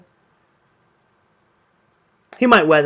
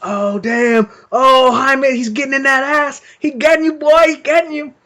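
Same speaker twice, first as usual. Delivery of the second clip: much higher, louder hype, like coworkers egging on a fight; hyped crowd cheer; 9 semitones higher, 5 dB louder than usual. Retold or imitated. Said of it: "everyone's kinda going wild".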